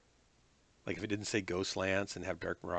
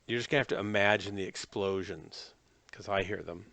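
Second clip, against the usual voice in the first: ordinary talk, nothing marked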